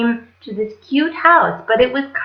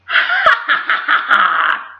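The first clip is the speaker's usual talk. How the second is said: evil laugh; 5 semitones higher than usual. Acted. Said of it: "said the wicked queen".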